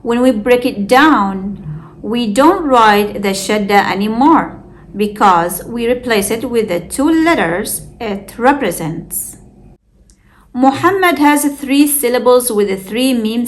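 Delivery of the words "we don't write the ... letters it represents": ordinary talk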